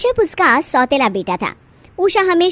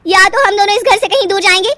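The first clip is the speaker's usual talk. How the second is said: louder, higher, tearful edge; a wife's louder, higher ultimatum to her husband; angry wife's ultimatum; 7 semitones higher, 5 dB louder than usual. Acted. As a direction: force him to choose.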